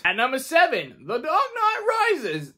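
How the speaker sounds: slow, masked villain voice; Bane impression. Shown as a demonstration. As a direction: do Bane announcing the title. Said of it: "That was literally my Bane impression for you".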